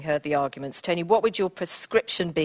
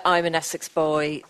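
normal talking voice

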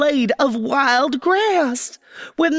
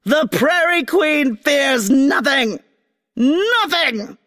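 slow shout, each syllable cracked like a whip; 4 semitones higher, slower than usual. Acted. Queen shouting down the chanting schoolgirls, whip-crack syllables. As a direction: snap every syllable like a whip. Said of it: "wispy whip crack to each syllable".

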